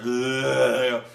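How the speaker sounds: loud wet villain grunt; mock drooling villain groan. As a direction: gurgle it like a drooling brute. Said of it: "and he's drooling".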